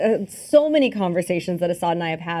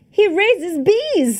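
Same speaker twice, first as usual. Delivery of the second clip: high, drawn-out mock disbelief; mock outrage; 12 semitones higher, 4 dB louder, much slower than usual. Fan hyping up a beekeeper action hero, mock outrage. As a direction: stretch it out in comic outrage.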